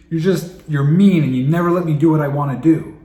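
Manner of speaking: put-on whiny angry child. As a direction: protest like a teenager who's been told no. Child's complaint acted out.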